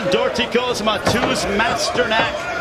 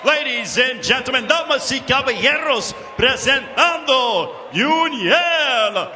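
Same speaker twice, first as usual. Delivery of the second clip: lucha libre announcer; 5 semitones higher than usual. Acted. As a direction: stretch the names, hype the crowd. Ring announcer, Spanish intro.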